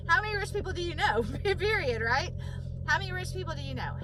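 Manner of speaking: fast, loud, high rhetorical jab with laughter; excited mocking question; laughing challenge. Real, not acted. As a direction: fire the question at them, laughing.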